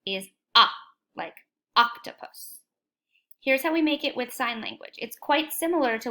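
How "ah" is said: loud open ah; modelled ah sound; short o sound. Shown as a demonstration. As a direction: stress the vowel ah. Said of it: "the short sound ... is".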